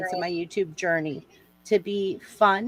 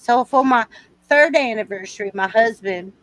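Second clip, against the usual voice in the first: louder, boastful Southern belle; Southern lady bragging, raised pitch; Southern accent; 3 semitones higher, 5 dB louder than usual. Acted. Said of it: "I like your Southern accent".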